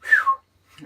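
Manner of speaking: high loud relieved exhale; big whew. Real, not acted. Vlogger catching her breath after rambling, loud whew.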